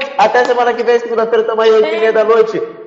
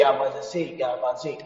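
[name]'s voice; 8 semitones lower, 13 dB quieter than usual. Retold or imitated. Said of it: "ela tá falando assim".

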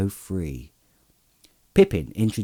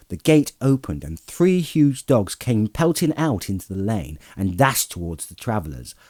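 ordinary delivery, nothing marked

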